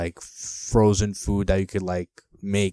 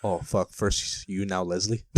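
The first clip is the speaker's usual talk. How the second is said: ordinary talk, nothing marked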